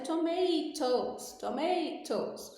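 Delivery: slow British "tomatoes" with a crisp clear t; British accent, to-MAH-toes with every t sounded; British English. Shown as a demonstration. Teacher shows the Queen's English "tomatoes". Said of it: "o T é bem pronunciado".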